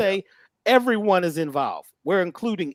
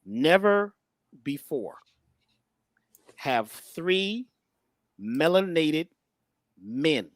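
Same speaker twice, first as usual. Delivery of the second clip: each word set apart by long pauses; solemn, spaced-out announcement; dramatic pauses; 3 semitones lower, much slower than usual. Real, not acted. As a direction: let every word land alone, pause between.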